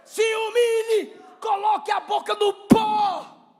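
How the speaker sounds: high, shouted sermon at full force; fiery preaching. Real, not acted. Preacher humbling the church with Paul's confession, impassioned shouting. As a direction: cry it out to the congregation in long, ringing phrases.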